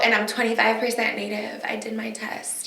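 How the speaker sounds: unvoiced aside, like a secret confession; whispered mock admission of ancestry. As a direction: whisper it as a sheepish reveal.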